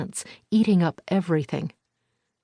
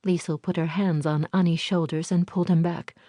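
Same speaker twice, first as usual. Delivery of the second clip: ordinary delivery, nothing marked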